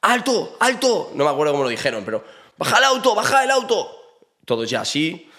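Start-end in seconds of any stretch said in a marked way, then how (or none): 0.0-1.1 high barked command to stop; police shouting halt. Retold or imitated. 2.6-3.9 police shouting orders. Retold or imitated. Police ordering them out of car.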